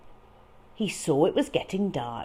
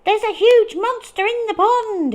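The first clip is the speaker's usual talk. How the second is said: high, loud panicked cry; frightened cry; 10 dB louder, faster than usual. Acted. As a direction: blurt it out terrified. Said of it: "he cried".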